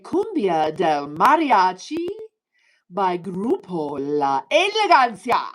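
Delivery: Spanish-accented song title. Real, not acted. Streamer asking Alexa for a song, slow Spanish title. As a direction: enunciate the title in Spanish for the speaker.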